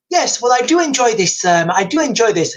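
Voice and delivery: usual speaking voice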